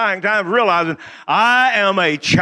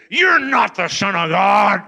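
slow, loud, in-your-face accusation; mock confrontation; 4 dB louder, slower than usual. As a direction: imitate the devil shouting at the Lord. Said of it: "get right in his face and said".

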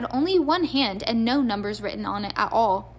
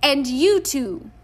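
slow, loud, stern warning; stern glare; 3 semitones higher, 5 dB louder, slower than usual. A narrator's reading. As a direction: glare while you say it. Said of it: "she said, glaring at Ron".

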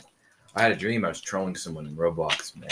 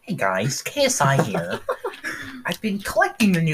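higher mock YouTuber greeting with a laugh; 13 semitones higher than usual. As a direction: laugh through a British intro. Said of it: "He's British".

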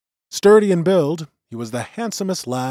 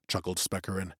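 ordinary delivery

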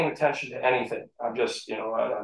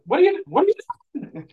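wife's shocked voice; 4 dB louder, faster than usual. Retold or imitated. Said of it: "Like, you know, like".

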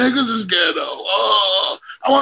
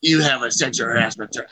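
mock a regretful boss, voice dropped; 7 semitones lower than usual. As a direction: deliver the firing low and measured.

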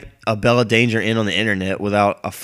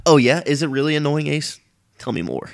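higher, teasing fake interviewer; mock therapist voice; 4 semitones higher than usual. Vlogger voicing a sarcastic listener, teasing and mock-sympathetic. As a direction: needle yourself like a smug listener.

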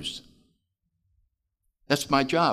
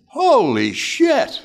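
much higher, louder, slow astonished blurt; stunned exclaim; 11 semitones higher, 6 dB louder, much slower than usual. Retold or imitated. Minister stunned that the boy's prayer worked, blurting out. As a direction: let it burst out in disbelief. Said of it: "And the minister said".

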